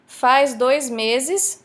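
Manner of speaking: Faz dois meses said very slowly, word by word; teacher breaking down Faz dois meses slowly; slow demo. Shown as a demonstration. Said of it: "so the verb, fazer".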